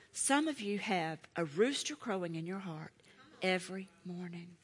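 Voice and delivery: normal talking voice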